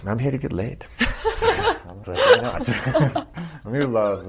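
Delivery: retold announcement, broken up by giggles; laughing confession. Man retells his blunt announcement to the circle, laughing. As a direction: laugh through the cheeky admission.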